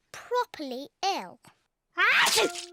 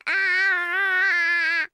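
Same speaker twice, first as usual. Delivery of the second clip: loud ah; 2 semitones lower, 4 dB louder than usual. Shown as a demonstration. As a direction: mouth wide open, a loud long ah. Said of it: "show George how to say, "Ah".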